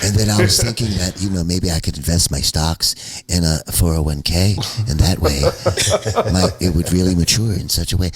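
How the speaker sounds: low, proper, lightly mocked suburban talker. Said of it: "that's the real voice".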